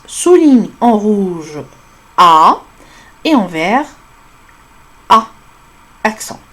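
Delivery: Souligne en rouge drawn out very slowly; teacher dictating, word by word; slow dictation. Shown as a demonstration. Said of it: "dictating instruction slowly".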